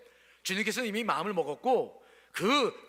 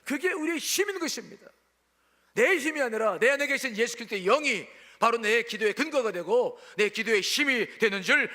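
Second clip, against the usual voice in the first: loud, raised-pitch pulpit exhortation; fervent preaching; 4 semitones higher, 6 dB louder than usual. Real, not acted. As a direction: press the congregation for an amen. Preaches at full force. (힘 있게 외치는 설교)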